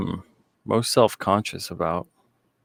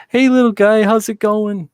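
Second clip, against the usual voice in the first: put-on high-pitched, louder baby talk; little dumb baby voice; 12 semitones higher, 6 dB louder than usual. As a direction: gush at a dog like it's a little baby. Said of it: "little dumb baby voice".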